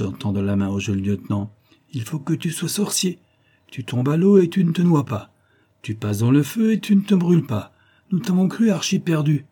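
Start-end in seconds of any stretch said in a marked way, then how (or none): 1.9-9.5 higher-pitched, lively astonishment; amazed marvelling. A narrator's reading. Nicolas greets the friend believed lost, marvelling. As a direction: marvel at each escape.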